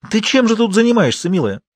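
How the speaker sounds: high, accusing maternal question; outraged mother, acted; indignant scolding. A narrator's reading. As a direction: demand an explanation, pitch shooting up. Mother confronts daughter.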